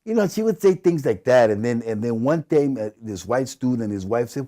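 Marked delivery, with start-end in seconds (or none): none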